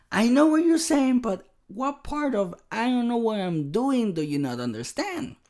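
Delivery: high-pitched, exasperated protest; exasperated retort. Retold or imitated. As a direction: act out a fed-up husband arguing back. Acted exasperated reply to wife.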